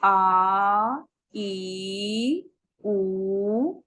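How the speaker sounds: आ, ई, उ drawn out long; long vowels demo. Shown as a demonstration. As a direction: hold each vowel आ ई उ for two beats. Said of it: "तो अगर हम बोलकर देखें". (दीर्घ स्वर लंबा खींचकर)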